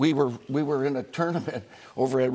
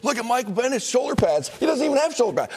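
higher, animated complaint about the pads; excited retelling; 9 semitones higher, faster than usual. Retold or imitated. Mooch calling John back about a player's pads, excited. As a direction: point it out like a fired-up fan. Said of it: "and then say".

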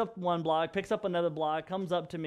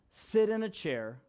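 normal talking voice

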